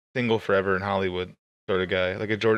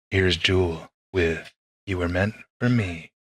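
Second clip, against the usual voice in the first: silky DJ voice; 5 semitones lower, slower than usual. Retold or imitated. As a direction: announce the song like a smooth late-night DJ. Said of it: "He had, like, a silky smooth voice".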